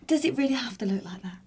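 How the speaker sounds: whispered aside. Real, not acted. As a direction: whisper it under your breath. Aside mocking the bash guard.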